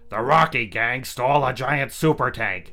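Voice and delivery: loud, high military report; urgent general. Acted. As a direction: report the emergency. Army general uncle reporting.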